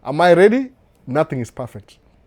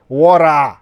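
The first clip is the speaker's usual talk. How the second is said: "Wara" with the American flapped T as a D sound, louder; American accent; 7 dB louder than usual. Shown as a demonstration. Showing viewers how Americans say water, in an American accent. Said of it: "Accent ya America ni da".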